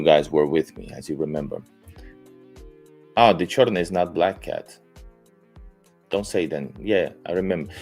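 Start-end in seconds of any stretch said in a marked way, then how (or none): none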